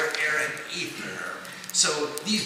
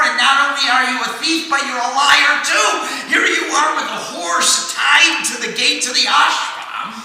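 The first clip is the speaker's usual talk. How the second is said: much louder, higher, fast angry tirade; furious yelling; 8 semitones higher, 8 dB louder than usual. Acted. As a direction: shout him down as a thief. Sons abuse the sage.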